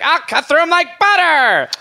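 high crazed menacing voice; mad cabin boy voice. Retold or imitated. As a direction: play a giddy unhinged sailor.

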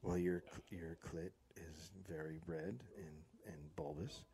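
low, hushed and soothing; soft and calm, no judgment; soft, gentle voice. Shown as a demonstration. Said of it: "you go like this".

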